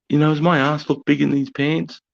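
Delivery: high, loud mock-girlish question; hammed-up imitation of a female officer; female lieutenant. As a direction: pitch it up to mimic a young woman. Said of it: "this chick, mate, she comes out".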